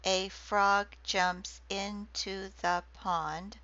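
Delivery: very slow, each syllable set apart; slow syllable-counted reading. Shown as a demonstration. Lecturer showing haiku syllables to class, very slow reading. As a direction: count the syllables out as you say them.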